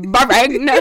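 loud, badly faked Jamaican accent; mock Jamaican accent. Copying funny video for co-host, botched Jamaican accent. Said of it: "This is a Jamaican".

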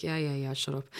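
soft, brushed-off "yeah yeah yeah, shut up"; dismissive mutter. Retold or imitated. Host retelling brushing off a friend, soft dismissive mutter. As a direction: wave it away under the breath. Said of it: "And I was like".

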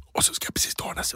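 soft, voiceless whisper. Retold or imitated. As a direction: whisper it like you have no voice. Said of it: "problem med rösten". (tonlös viskning)

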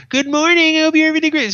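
high, loud sing-song cheer; mock exuberant barista; fake cheerful greeting. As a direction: overdo the chirpy morning hello. Mocking over-cheerful greeting.

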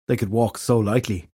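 ordinary delivery, nothing marked

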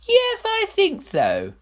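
high, slow feigned nonchalance; offhand king. Acted. King avoiding the adviser's eye, high and offhand. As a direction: pretend not to care. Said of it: "pretending to be very interested".

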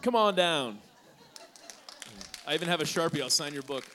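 loud, high call to the back; yelling across the room. Real, not acted. Calling out to mom in audience.

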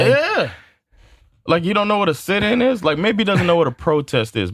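riled up and mocking his ignorance; worked-up disbelief. Real, not acted. Heated reaction to coach's quote.

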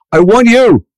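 high, pressing plea, slowing on I want you; urgent pleading. Retold or imitated. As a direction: beg him to take the part. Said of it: "He goes".

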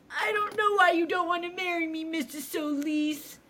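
high, loud whiny mock wail; mock tearful outburst, pitched high and loud; mock hysterical John. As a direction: ham up a hysterical boyfriend pleading. Retelling John's screaming fit.

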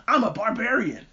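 high put-on barbarian boast; brash barbarian. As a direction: proclaim it like a cartoon brute. Acting out the brash barbarian character.

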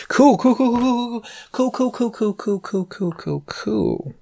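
high string of rapid-fire cools, fading away; sarcastic acceptance. Acted. Sarcastic reaction to the fee.

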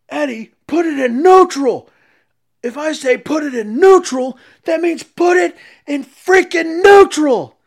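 furious high yell at a bumbling helper; screaming boss. Retold or imitated. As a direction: bellow the order, losing patience. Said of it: "screaming at him".

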